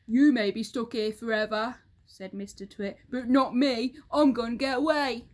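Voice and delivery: Mister Twit boasting. Acted. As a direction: sneer at the wife.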